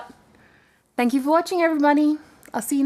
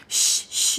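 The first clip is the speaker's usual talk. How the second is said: breathy unvoiced shh to scare off a hare; shooing hush. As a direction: hush the rabbit away. Girl shooing the hare away.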